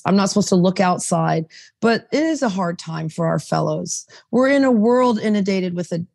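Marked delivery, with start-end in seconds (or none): none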